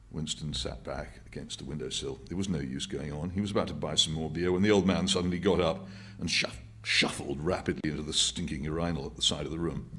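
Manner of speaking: plain reading voice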